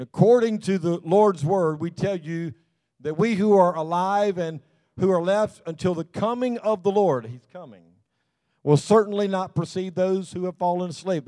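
measured, earnest Bible reading to the church; lightly lifted pulpit reading voice; scripture reading. Acted. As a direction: read the verses out as a preacher. Reads scripture aloud to congregation.

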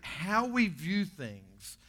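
ordinary talk

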